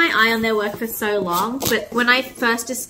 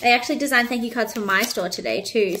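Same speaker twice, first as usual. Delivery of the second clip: usual speaking voice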